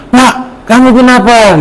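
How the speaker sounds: high, startled wife asking; worried wife calling out; alarmed wife. Acted. Wife asks husband.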